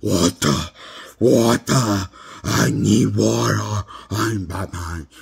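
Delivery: hoarse, parched growl, slowly forced out; mock strained, thirsty hero voice; Batman. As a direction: rasp it out like a superhero desperate for water. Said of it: "That's his voice".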